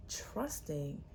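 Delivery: ordinary delivery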